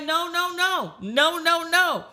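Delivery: high, rapid protest; dad protesting. Retold or imitated. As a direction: brush off the accusation.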